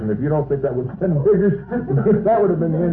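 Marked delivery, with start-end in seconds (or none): 1.7-2.2 short laugh. Real, not acted. Laughs mid-speech.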